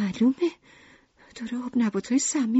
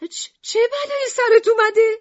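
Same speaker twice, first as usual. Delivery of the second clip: very high, louder startled question; alarmed; 5 dB louder than usual. A narrator's reading. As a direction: stammer into a high shocked question. Lucrece alarmed at pale Ernest.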